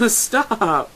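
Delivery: high, loud "stop"; giggly, drawn-out plea to stop; playful protest. Acted. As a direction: squeal it out in mock protest. Lyra protests amid helpless laughter.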